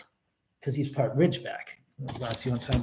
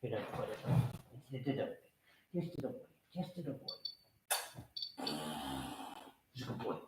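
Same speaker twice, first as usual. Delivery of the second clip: soft praise; 4 semitones lower, 18 dB quieter, much faster than usual. Real, not acted. Soothing dog quietly.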